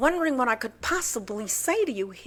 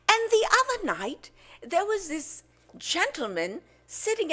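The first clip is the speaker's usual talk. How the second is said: celebrity impression with big pitch swoops; lilting British gossip telling a joke; Sharon Osbourne. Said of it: "Next we have Sharon Osbourne".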